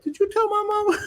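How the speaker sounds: very high-pitched comic squeak; mock alarmed question. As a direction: ask it in a put-on high voice, laughter close behind. Acted question to wife, laughing after.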